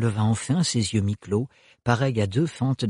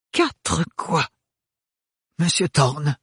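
a touch higher, hesitant; unsure voice; 4 semitones higher, slower than usual. A narrator's reading. The father standing up to Thorne, unsteady voice. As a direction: ask it shakily but firm. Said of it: "d'une voix mal assurée".

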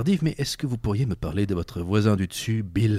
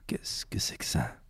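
whisper; 6 semitones lower, 9 dB quieter than usual. Acted. Detective puzzling over the song.

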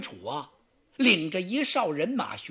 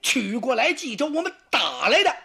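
rising, louder indignant answer; angry retort; 4 semitones higher, 4 dB louder than usual. Acted. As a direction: Xu Chu flares up and snaps back. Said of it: "这火就上来了". (许褚火冒三丈地反驳)

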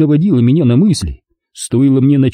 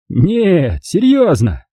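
higher, insistent; Kurt insisting; 7 semitones higher, much slower than usual. Acted. Kurt presses on at dinner, higher voice. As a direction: push the joke on. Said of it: "продолжал Курт".

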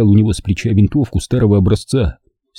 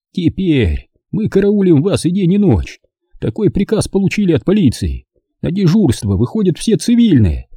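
put-on higher, worn old man's voice; 9 semitones higher than usual. A narrator's reading. Captured old man explains himself to partisans, higher old voice. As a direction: play the frightened old German. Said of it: "пояснил старик".

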